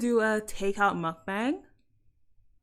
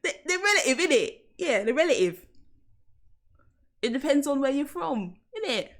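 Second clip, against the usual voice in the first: laughing London accent; 4 semitones higher than usual. Shown as a demonstration. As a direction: laugh through it, South London. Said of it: "I just switched to English".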